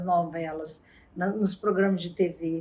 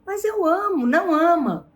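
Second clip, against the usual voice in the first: higher and louder, arguing back; heated quarrel voice; 8 semitones higher, 5 dB louder than usual. Retold or imitated. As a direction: play both lovers snapping at each other. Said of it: "e a discussão continuava". (discussão acalorada, voz mais aguda e forte)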